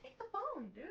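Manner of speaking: high singsong coaxing; coaxing pet voice. Real, not acted. Owner coaxing the dog to take the phone. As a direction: tease the dog into grabbing it.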